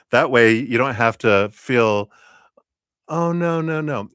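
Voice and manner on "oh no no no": high-pitched mock "oh no" of refusal; acted protest. Streamer acting out reluctance to accept a gift, high protest. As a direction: play a reluctant receiver, pitched well up.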